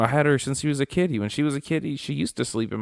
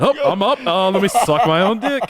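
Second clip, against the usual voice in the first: loud, high-pitched cat character voice; talking cat; 9 semitones higher, 7 dB louder than usual. Acted. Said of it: "He said".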